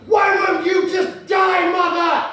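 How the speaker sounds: loud, high, slow yell across the stadium; shouted heckle. Retold or imitated. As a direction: lean back and bellow it. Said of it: "loud as I could and I shouted out".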